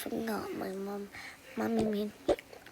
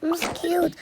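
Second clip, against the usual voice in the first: pretend scared cry; 7 semitones higher, 6 dB louder than usual. Pretend stuck, calling out.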